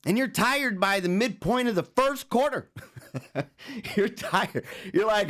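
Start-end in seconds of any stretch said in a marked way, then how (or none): none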